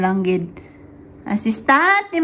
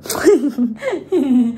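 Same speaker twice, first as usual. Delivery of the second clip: laugh. Real, not acted. Laughing with baby.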